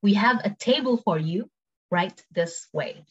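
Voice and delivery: measured words, slowing at the end; careful, spaced-out model phrase; slow take. Shown as a demonstration. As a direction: break it up slowly for learners. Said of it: "cepet sama pelan".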